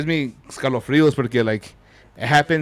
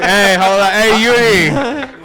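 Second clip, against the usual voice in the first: loud, high, drawn-out heckle; big raucous yell with stretched words; jeering shout; 7 semitones higher, 9 dB louder, much slower than usual. Real, not acted. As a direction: holler it at the whole room. Jeering loudly at a friend.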